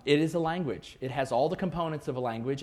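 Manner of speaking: ordinary talk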